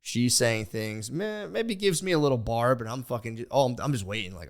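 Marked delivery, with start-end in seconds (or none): none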